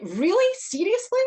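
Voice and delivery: high, loud, incredulous disbelief; can't believe the cheek. Retold or imitated. Hearing the dog's cheek, she asks in high, loud disbelief. Her incredulous reaction retold.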